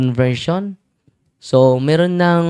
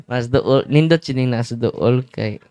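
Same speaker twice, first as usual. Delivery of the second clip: ordinary talk, nothing marked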